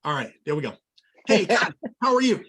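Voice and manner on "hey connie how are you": bright, louder call greeting; upbeat phone greeting. Shown as a demonstration. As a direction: greet her loud and lively on the phone. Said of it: "let's practice this".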